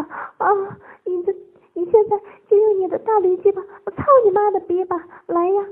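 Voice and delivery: high, loud, wailing pleas; frenzied calling broken by gasped cries. A narrator's reading. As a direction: cry out urgently at high pitch. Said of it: "大声叫道".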